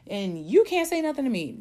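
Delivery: high, loud mock-defiant quote; loud, high sassy attitude; bossy disrespectful. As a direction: play the mouthy young upstart. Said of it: "you get disrespectful and you get bossy with people and you're like".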